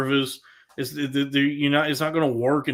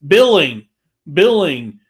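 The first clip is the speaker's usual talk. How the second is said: louder, barked menu word, repeated; loud robot command; 3 semitones higher, 10 dB louder, slower than usual. Retold or imitated. Caller shouting a menu option at a phone robot, loud and firm. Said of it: "say, like".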